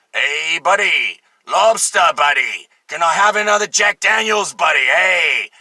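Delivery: drawn-out, calling heckler; hammed-up drunk hailing a server; mock drunk customer. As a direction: call out like a boozy bar customer.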